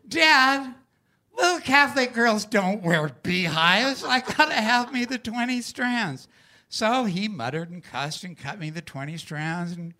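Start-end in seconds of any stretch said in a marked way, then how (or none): 0.1-6.3 a hint of a stubborn child, higher and louder; a little kid digging in their heels; insistent kid. Kid insisting to father in story.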